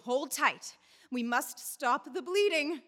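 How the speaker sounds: usual speaking voice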